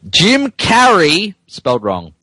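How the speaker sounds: slow, drawn-out dramatic title; high, slow mock-epic reading; mock grand title read. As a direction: announce it like a movie trailer, slowly. Reading a silly title aloud.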